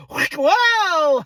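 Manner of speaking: high, comic "whoa" yelp; hammed-up high wail; mock alarmed yelp. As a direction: wail as if hoisted up. Hoisted up on the harness.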